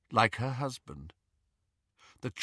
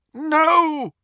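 anguished cry; 14 semitones higher, 10 dB louder than usual. Acted. Wizard cries out in anguish.

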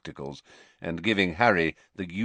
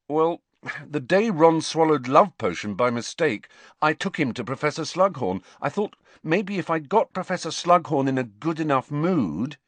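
higher, louder youthful excuse; Harry, awkwardly explaining; 8 semitones higher, 4 dB louder than usual. A narrator's reading. Pupil explains his feeble attempt to the headmaster, awkward and earnest. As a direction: explain yourself in a higher, eager voice.

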